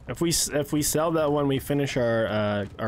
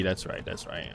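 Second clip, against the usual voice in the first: quiet aside; 7 semitones lower, 7 dB quieter than usual. Real, not acted. Quiet aside while teammates practice.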